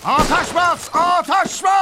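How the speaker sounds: high, full-force battlefield yell; shouted command. Acted. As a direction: yell the order so everyone stops. Ordering cease fire.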